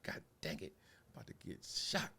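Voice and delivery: low, hushed fearful thought; fearful mutter. Retold or imitated. Comic as his teen self realising it's a gun, hushed fear. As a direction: mutter it under your breath, scared. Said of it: "I was like".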